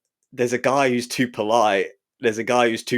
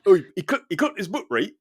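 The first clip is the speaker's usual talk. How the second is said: Geordie laid on thick, much higher; thick Geordie accent; 8 semitones higher, faster than usual. Shown as a demonstration. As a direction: ham up the Geordie, pitched high. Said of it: "I wanna hear it laid on thick".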